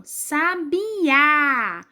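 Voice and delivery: sabiá very slow, with a long strong á at the end; sabiá syllable by syllable, last one strongest; stress on final A. Shown as a demonstration. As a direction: stretch sabiá and land hard on the á. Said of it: "eu estou falando com mais força o a".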